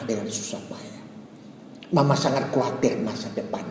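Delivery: mother confiding her worries in a soft, hushed voice; a hint of weeping, soft and low; mild tearful mother. Mother tells her son of her fears, softly and tearfully. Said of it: "Mama terus ceritakan".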